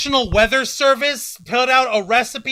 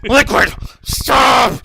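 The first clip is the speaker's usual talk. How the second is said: loud mock plea; mock shout; 5 dB louder, much slower than usual. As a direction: yell it like a game cutscene. Metal Gear meme quote.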